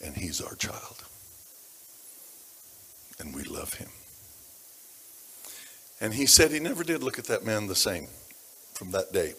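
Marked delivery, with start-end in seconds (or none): 0.0-3.9 soft, low and tender; tender hush. Real, not acted. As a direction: let it fall to a tender murmur. Tender words about the child.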